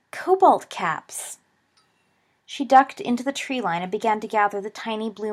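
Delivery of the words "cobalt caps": higher and slow, savouring the find; drawn-out delighted exclamation; cry of delight. Acted. Lily spotting mushrooms in the forest, delighted cry. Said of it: "Lily gave a cry of delight".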